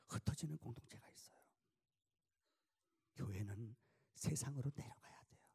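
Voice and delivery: intimate pulpit whisper. Acted. Preacher whispering the church's calling to listeners. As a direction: lean in and whisper the lesson.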